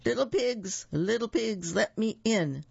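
lightly voiced wolf calling from outside; booming, barky. A narrator's reading. Wolf calls to the little pigs from outside, mock booming. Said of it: "a great booming, barky sort of voice shouted".

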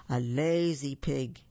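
usual speaking voice

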